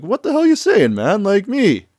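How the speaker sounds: high, loud mock outrage; incredulous protest. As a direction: act out baffled indignation, pitch leaping up. [name] protests at being denied.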